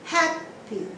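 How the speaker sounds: Happy split, high HAP then light py; stress on first syllable. Shown as a demonstration. Teacher demonstrates the rule, stress on HAP in Happy. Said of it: "You stress on the first syllable".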